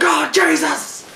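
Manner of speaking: high, loud, drawn-out wail; mock agony. Big Show hit by the chair, hammed-up pained yell. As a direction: howl as if the chair just hit. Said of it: "And Big Show's like".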